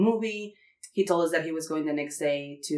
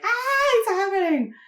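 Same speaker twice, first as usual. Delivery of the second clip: high, drawn-out squeal of anticipation; excited squeal; 14 semitones higher than usual. Retold or imitated. Women seeing the sign, squealing with excitement. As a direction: squeal that the big moment has come.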